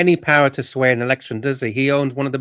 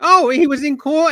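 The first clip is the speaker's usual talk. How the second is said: mocking imitation; 9 semitones higher than usual. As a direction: mimic him in a silly high voice. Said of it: "Archie, you said".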